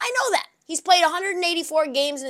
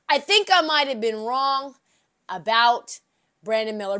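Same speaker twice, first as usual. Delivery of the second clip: with more bass. Shown as a demonstration. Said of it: "say it with a little more bass in my voice".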